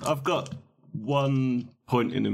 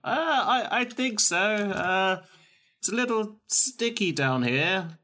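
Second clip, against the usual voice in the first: chirping bird person; 10 semitones higher than usual. Acted. Voicing Mort from pit.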